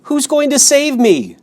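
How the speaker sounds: high, loud desperate question; piglet's eager hope; eager plea. Acted. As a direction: beg to know. Wilbur pleading.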